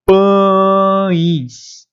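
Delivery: "pães" said as "pãis", nasal A gliding into I; teacher spells out the sound of pães; pães with I glide. Shown as a demonstration. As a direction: stretch out pães so the I is heard. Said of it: "Ó, um I".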